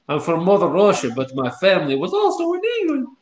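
Russian-English accent climbing high at the end; high-pitched Russian English. Shown as a demonstration. Showing friends his Russian-English accent, climbing to high pitched. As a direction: start Russian, then pitch up for England. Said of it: "You gotta go high pitched".

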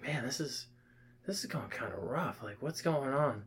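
half-choked quiet complaint; quiet, like talking while drowning in beer; strained mutter. Retold or imitated. Struggling mid-funnel, quoting himself.

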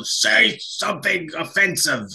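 high, drawn-out mock heckle; slow, hammed-up audience taunt; crowd heckler. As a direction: goad the singer from the crowd. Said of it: "the crowd just like".

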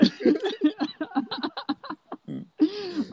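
high, long giggle; laughing. Real, not acted. Guest laughing about the shove, high giggle. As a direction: laugh along warmly.

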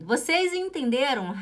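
ordinary talk

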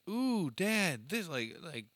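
put-on high little-kid begging voice; toddler pleading. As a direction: ask dad like an eager two-year-old. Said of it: "she's like".

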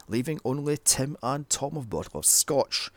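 usual speaking voice

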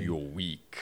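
low menacing crime boss; Kingpin voice. Pretend. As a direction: growl it low like a villain. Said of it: "my Kingpin is better than yours".

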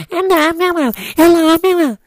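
very high, loud squeaky puppet voice; Elmo. Acted. Owner mocking the vet's idea, high puppet impression. Imitates Elmo after vet suggests it.

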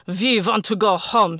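loud bellow from the chest. Acted. Arkady screaming at the sky, loud bellow. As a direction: roar it at the sky. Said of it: "he bellowed".

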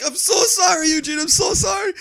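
put-on old driver apologises to the man he hit, high frantic wail. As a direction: plead with him like a panicked old driver. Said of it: "He's like".

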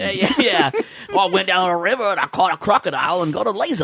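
quick comic Aussie patter. Acted. Riffing on crocodiles and lasers, hammed-up Australian accent. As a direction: rattle it off in mock Australian. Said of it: "Sorry, Australians".

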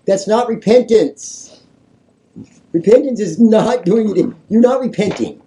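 loud, high preaching outburst; worked-up, forceful scolding of the congregation; emphatic rebuke. Real, not acted. Preacher rebuking the false repentance.

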